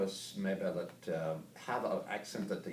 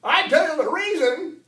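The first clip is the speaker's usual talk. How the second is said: put-on high, loud cranky old-timer; grandpa character; 16 dB louder than usual. Grandpa warning the audience, high loud voice. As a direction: holler it high like an excitable grandpa.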